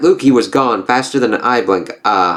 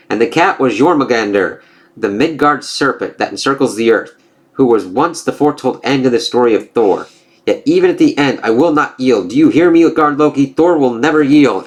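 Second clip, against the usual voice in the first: defiant declaration; 4 semitones higher than usual. Acted. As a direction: proclaim it to the giant king. Reads comic dialogue aloud.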